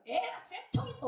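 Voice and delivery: high-pitched mocking jeer; mock gloating. Person gloating at their own foot that stepped in dung, mock jeer.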